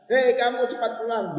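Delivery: high-pitched demo of a heated order; emotional command. Shown as a demonstration. Teacher shows how emotional people say it, high command. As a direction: call it out high, as if worked up. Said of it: "dalam perasaan emosi".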